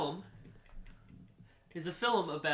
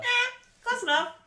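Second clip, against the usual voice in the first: much higher, whiny dismissal; mock whine; 5 dB louder than usual. Real, not acted. Shrugging off being corrected.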